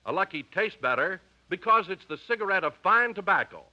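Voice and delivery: usual speaking voice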